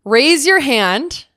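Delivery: high, loud, slow call out; rally the listeners. Real, not acted. Asking ADHD queens to join in, a loud slow call. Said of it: "if you are an ADHD queen".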